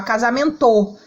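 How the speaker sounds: casamento with the stress wrongly thrown onto the last syllable, "to"; wrong final stress. Shown as a demonstration. Teacher testing a wrong guess for the class, casamento stressed on final "to". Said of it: "a palavra seria pronunciada assim".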